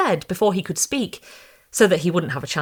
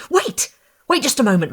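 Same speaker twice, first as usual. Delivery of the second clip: a sudden high Wait, then hurried and eager; shrill, excited; 7 semitones higher, 4 dB louder than usual. A narrator's reading. Florist calling the bride back at the door, mildly excited. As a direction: call her back before she leaves, mildly excited. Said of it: "in a shrill, excited voice".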